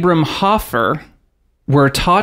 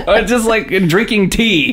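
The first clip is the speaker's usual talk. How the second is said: ordinary talk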